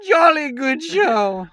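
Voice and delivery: high, slow posh colonel; pompous sputtering colonel. Pretend. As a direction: ham up a pompous British officer. Mock British toast.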